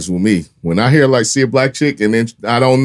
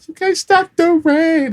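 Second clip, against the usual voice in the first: high, slow quoted line; drawn out, like the actor said it; quoted movie line; slower than usual. Retold or imitated. Quoting a favorite actor line.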